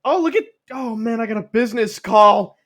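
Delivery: high, loud, flustered dodging; hammed-up cornered dad; panicked denial. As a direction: bluster loudly and grope for an escape. Acted dad escaping awkward confrontation.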